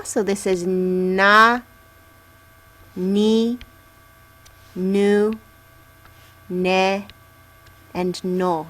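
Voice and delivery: na, ni, nu, ne, no said slowly with pauses; n row for repeating. Shown as a demonstration. Teacher leading students to repeat the n row. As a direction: leave gaps so they can repeat. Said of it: "So can you say this with me?".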